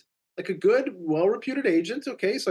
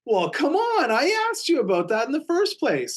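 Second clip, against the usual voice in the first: exasperated; 10 semitones higher than usual. Real, not acted. Deal collapsed after his warning.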